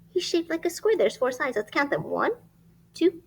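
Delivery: ordinary delivery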